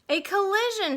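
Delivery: loud, high alarmed shout. Acted. Crowd on shore seeing the crash, alarmed shout. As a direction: cry out at the crash. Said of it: "shouted the crowd on shore".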